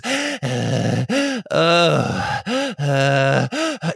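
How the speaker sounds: stammered, startled gasps; mock gasp. Friend recalling the jumpy movie volume, startled gasping. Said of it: "it fluctuated".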